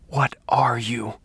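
slow terrified whisper. Acted. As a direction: breathe the question in fear. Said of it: "Father Donovan whispered".

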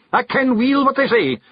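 a snapping Scots retort; snapped. Acted. Rob snaps at Billy, in a sharp Scots retort. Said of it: "snapped Rob Anybody".

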